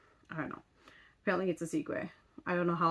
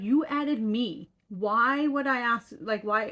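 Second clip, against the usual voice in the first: indignant retort; 4 semitones higher, 6 dB louder, slower than usual. Retold or imitated. Retelling a standoff with her dating-app match.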